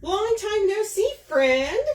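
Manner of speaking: high mock-cheery welcome; high greeting voice. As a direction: greet the mosquitoes like old friends. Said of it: "I'm like".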